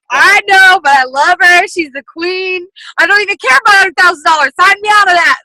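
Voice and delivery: high, loud starstruck gushing; hammed-up adoring shout. She replays telling friends she only cares about her idol, high and loud. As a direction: yell it like a devoted superfan. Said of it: "And I was like".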